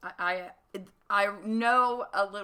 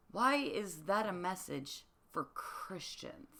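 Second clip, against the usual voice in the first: ordinary talk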